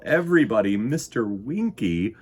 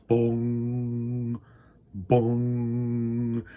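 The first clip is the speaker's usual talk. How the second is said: grandfather clock bong. A narrator's reading. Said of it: "chimed a cheery".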